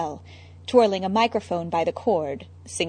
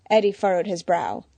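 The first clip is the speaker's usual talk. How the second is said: ordinary delivery, nothing marked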